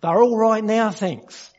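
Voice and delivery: high, loud mock call; echoed shouted reply. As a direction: call it out like the joke's punchline.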